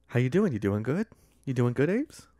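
soft Boston accent with rising endings. Shown as a demonstration. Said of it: "a little bit of a Boston accent".

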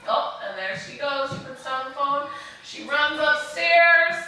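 sing-song picture-book rhythm; animated read-aloud to a class; reading to children. A narrator's reading. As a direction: lilt it like a bedtime story for little ones. Reads rhyming picture book aloud.

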